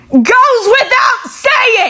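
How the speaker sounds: high slow shouted punchline; exasperated shout landing the joke; emphatic shout. Real, not acted. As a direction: belt the punchline out. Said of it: "punchline, shouted".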